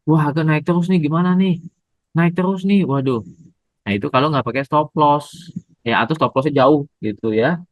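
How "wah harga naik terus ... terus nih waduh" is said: mock panic. Voicing a panicking trader.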